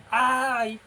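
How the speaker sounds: loud, drawn-out "¡Ay!"; demonstrated cry. Shown as a demonstration. Teacher showing where exclamation marks go, demonstrated cry. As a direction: cry out a long ay as an example. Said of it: "es un grito".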